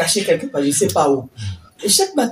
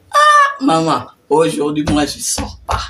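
teasing son; 8 dB louder, slower than usual. Retold or imitated. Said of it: "Et lui dit". (fils taquin, à peine joué, plus fort)

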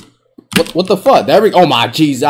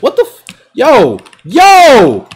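shocked yelp; 7 semitones higher, 6 dB louder, much slower than usual. Real, not acted. Shocked at illegal layup.

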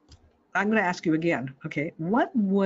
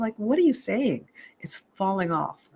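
ordinary talk

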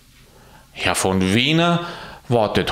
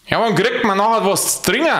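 quick, higher grumbling Bavarian porter; Alois asking; 9 semitones higher, faster than usual. Acted. Alois asks Petrus for a drink, quick and higher. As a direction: blurt it out fast like a thirsty Munich porter. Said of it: "fragte Alois".

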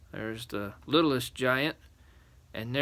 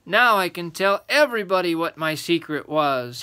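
put-on cheerful, raised boy's voice; smiling boy; 5 semitones higher, 8 dB louder than usual. A narrator's reading. Little boy revealing his secret, cheerful. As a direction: announce it happily with a smile. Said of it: "he said with a smile".

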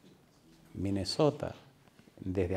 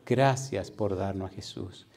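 prayer; slower than usual. Real, not acted. Preacher thanking the Lord for his word, reverent prayer. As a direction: bring it down into a measured prayer.

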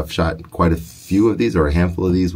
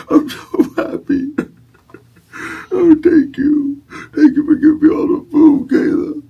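very high sobbing falsetto; hammed-up tears of joy; mock happy crying; 5 dB louder than usual. Dad fake-cries with joy.

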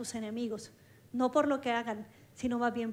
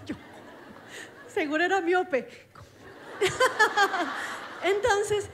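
bursting into real laughter, higher and louder; laughing; 6 semitones higher, 4 dB louder than usual. Real, not acted. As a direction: laugh through the punchline. Laughing at her own story.

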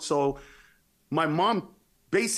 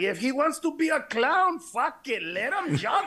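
put-on drunk father; 8 semitones higher, slower than usual.

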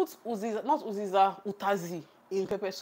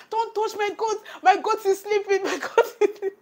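high, loud mock toddler outburst, much higher; angry toddler; 14 semitones higher, 7 dB louder than usual. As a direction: protest like a small girl defending her goat. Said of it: "Jasmine wanted to beat this man".

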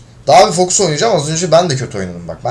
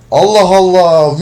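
louder, slow, drawn-out disbelief; exasperated disbelief; 5 semitones higher, 6 dB louder, slower than usual. Real, not acted. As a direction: stretch it out in fed-up disbelief. Lost another match on video.

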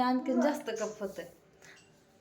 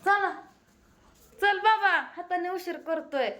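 much higher, loud urging; hurrying call; 8 semitones higher, 4 dB louder than usual. Real, not acted. Mother hurries son who is making himself late, loud and high.

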